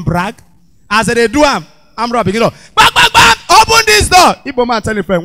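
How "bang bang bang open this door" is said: high, loud banging yell; armed robber shout. Retold or imitated. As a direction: pound the door and roar. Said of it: "Armed robbers go one Igbo man house".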